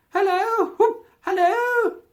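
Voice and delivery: very high, loud whooping calls; excited hallooing. Acted. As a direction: holler joyfully across a distance. Scrooge hallooing, carried away.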